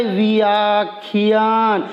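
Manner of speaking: each sound stretched very slowly; slow motion. Shown as a demonstration. Teacher slowing a word so pupils hear the parts. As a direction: drag the word out syllable by syllable. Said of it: "जैसे आपको क्या सुनाई देता है".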